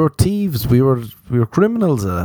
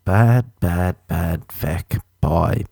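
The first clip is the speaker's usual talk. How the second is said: slow mock-naughty; 4 semitones lower, much slower than usual. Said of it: "I'm a bad".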